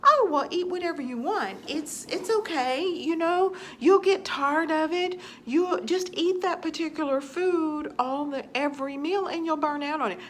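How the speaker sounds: a hint of cheery diet-guru voice; mocking the advice-givers. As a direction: parrot the easy advice in a sing-song. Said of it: "every person was saying".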